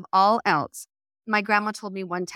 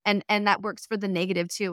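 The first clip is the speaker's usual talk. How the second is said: ordinary delivery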